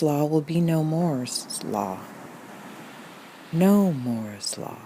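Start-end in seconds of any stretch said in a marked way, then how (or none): 3.5-4.8 drawn-out, lower meditative mantra; slow mantra. Pretend. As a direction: stretch out "No Moore's Law" slowly. Meditation mantra, slowly repeated.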